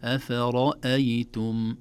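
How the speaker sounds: أفرأيتم light throughout with only the ra thick; light letters, heavy ra. Shown as a demonstration. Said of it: "هكذا".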